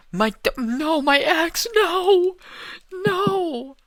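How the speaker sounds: high, loud panicked cries; panicked yelling. Real, not acted. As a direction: yell in panic as the axe vanishes. Losing his axe in game.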